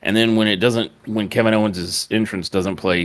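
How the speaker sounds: ordinary talk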